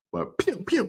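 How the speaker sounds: high pew pew gun noises; mock gunshots. Mimicking [name]'s shots at rivals with high pew sounds. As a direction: fire off playful pew pews.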